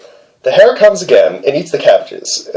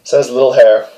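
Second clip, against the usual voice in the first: usual speaking voice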